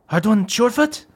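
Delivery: put-on high, surprised girl's voice; surprised teen dwarf. Teen dwarf recognizing old friends, high surprised voice. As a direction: recognize them, pitched high.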